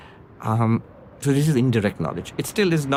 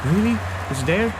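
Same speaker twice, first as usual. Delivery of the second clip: eager hope; 8 semitones higher than usual. Retold or imitated. Friends hear tenth is alive.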